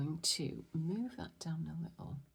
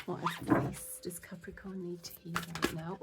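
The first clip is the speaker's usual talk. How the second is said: usual speaking voice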